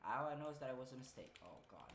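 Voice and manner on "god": ordinary talk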